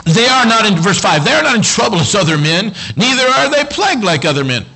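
high, punchy pulpit delivery; full-force preaching. Acted. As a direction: read the verse out like a fired-up preacher.

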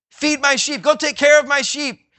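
high, loud, pressing charge; urgent commission. Pretend. Jesus urging Peter to care for others, loud and high. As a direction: send him out with urgent fire. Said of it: "He says that phrase".

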